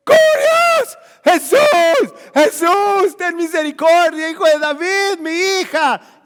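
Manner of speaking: loud, high cries for mercy; desperate shouting. Acted. Canaanite woman crying out to Jesus for her daughter, shouting. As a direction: scream out as a pleading mother.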